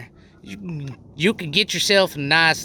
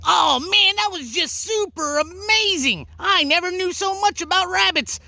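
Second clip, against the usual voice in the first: excited; 11 semitones higher than usual. Real, not acted. Host wraps up excitedly to camera.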